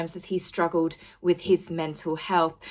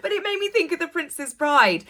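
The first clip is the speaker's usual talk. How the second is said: laughing; 9 semitones higher than usual. Real, not acted. Laughing at own impression.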